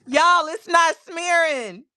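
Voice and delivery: excited. Real, not acted. Thrilled that the ink holds.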